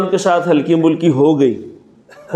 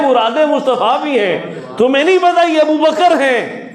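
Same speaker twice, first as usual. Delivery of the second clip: impassioned preaching; 5 semitones higher than usual. Acted. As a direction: build the plea until it bursts out. Preacher voicing Rabia, rising to shout. (جوشیلا خطیبانہ انداز، بلند ہوتی آواز)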